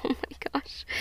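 choked-up, breathless oh my gosh; real laughing through tears; teary laugh-cry. Real, not acted. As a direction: overcome with tears, barely voiced. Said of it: "I can't get control of myself".

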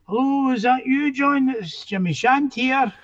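a hint of Jimmy's voice as he rings up John; Jimmy on the phone.

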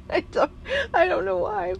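high, loud words through giggles; laughing while talking. Real, not acted. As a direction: talk through the giggle, a little flustered. Giggling over Scottish accent crush.

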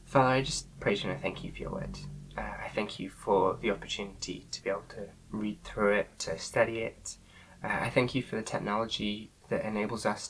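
soft, subdued opening prayer; softly spoken prayer to the Father. Real, not acted. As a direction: pray quietly and reverently over the study.

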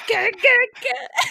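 high loud frustrated yelps; frustrated shout. Retold or imitated. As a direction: shout it out like slamming the keys. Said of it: "She goes".

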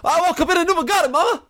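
a dying soldier sobbing out absurd news; Southerner dying at war. Acted. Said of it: "a Southerner dying at war".

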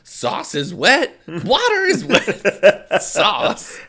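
put-on high, loud, slow Midwestern accent; hammed-up Midwestern, vowels stretched; mock Midwestern. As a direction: parody an insistent Midwesterner, laughing through it. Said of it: "making fun of Midwesterners".

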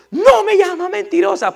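high, loud mock scolding; imitated angry grandmother. Grandmother scolding her grandson after a slap, high mock outrage.

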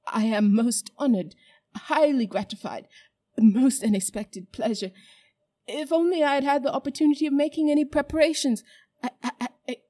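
put-on fawning old man. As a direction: gush flattery while panicking. Uncle Andrew fawning, terrified.

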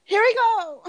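very high, loud mock crowd hype; imitated pop ad-lib shout; hyping ad-lib. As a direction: call it out like a pop star. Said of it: "hyping us up".

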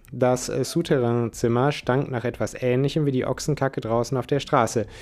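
usual speaking voice

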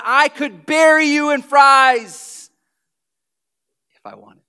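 loud, slow booming declaration; God's voice. Retold or imitated. Pastor voicing God to the stingy believer, loud and slow. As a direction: boom it slowly like God. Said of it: "God's going".

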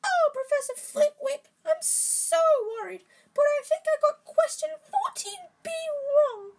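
high put-on voice. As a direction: ham up a fretful girl in shrill falsetto. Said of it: "he put on a high voice".

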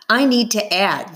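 ordinary delivery, nothing marked

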